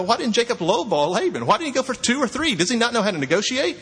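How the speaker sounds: normal talking voice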